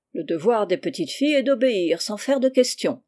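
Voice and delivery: ordinary delivery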